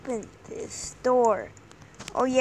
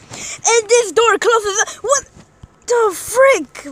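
exasperated cry; 8 semitones higher, 9 dB louder, much slower than usual. Real, not acted. Exasperated high cry at door.

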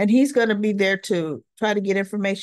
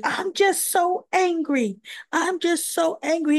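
imitated anger; 7 semitones higher than usual. Molly voicing anger, retold.

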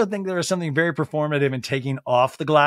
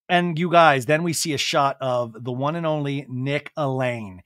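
ordinary talk

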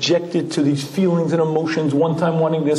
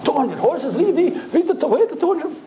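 put-on high, stammering, half-laughing old-timer; flustered and much higher, hunting for the horse; confused Shmuel; 11 semitones higher than usual. Shmuel in a moving car asking where the horse is, high and stammering. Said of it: "two hundred horsepower".

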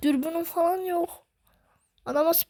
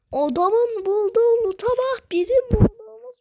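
higher, louder and slowed, complaining about the loot; excited outcry; 8 semitones higher, 5 dB louder than usual. Real, not acted. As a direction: wail it out in outrage at the loot. Envy over an enemy's loot. (heyecanlı, yüksek sesli sitem)

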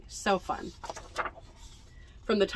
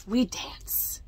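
whisper. Acted. Fox's secret whispered ending.

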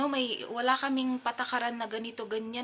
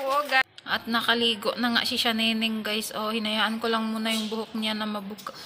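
usual speaking voice

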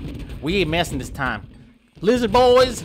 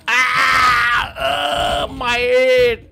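pained yell; 8 semitones higher, 8 dB louder, much slower than usual. Acted. Head hurt in game.

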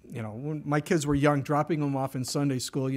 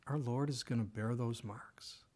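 whispered, softer, lower; hushed whisper; 5 semitones lower, 9 dB quieter than usual. Real, not acted. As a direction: drop to a reverent whisper. Preacher drops to hushed aside.

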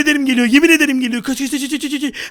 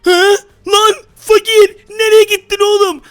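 slow, hammed-up wail, higher and louder; mock crying; 7 semitones higher, 7 dB louder, slower than usual. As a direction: wail after a vanished friend. Friend vanished after drinking. (yapmacık ağlama)

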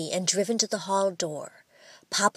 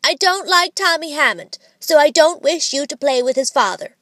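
higher, louder, a boy playing stern father; sly mock parent; 6 semitones higher, 5 dB louder than usual. A narrator's reading. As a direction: tease by bossing like a parent. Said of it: "said Harry, with a sly twinkle".

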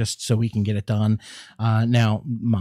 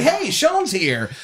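singsong greeting; 12 semitones higher, slower than usual. Retold or imitated. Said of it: "Usually I'd say".

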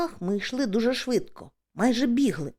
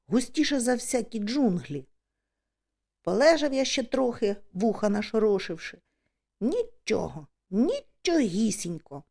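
ordinary delivery

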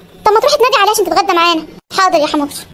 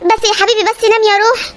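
ordinary talk

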